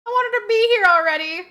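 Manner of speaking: loud, high impatient whine; impatient longing. Real, not acted. Expectant mother aching to meet her baby, impatient whine. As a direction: whine with eager longing, rising.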